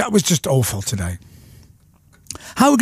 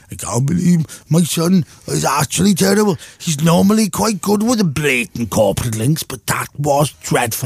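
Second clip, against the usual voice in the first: imitating dad. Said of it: "Your father ... going".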